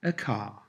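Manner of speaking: car with silent R. Shown as a demonstration. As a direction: end car on an open vowel, no R. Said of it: "is pronounced without the R".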